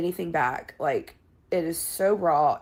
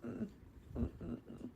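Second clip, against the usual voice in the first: mock sorrowful hum; 19 dB quieter than usual. Mock grief humming.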